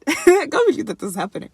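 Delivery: high, loud, giggly words; giggling through the line; laughing disbelief. Real, not acted. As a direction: laugh at yourself for getting emotional. Laughing at her own teariness.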